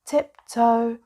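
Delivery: usual speaking voice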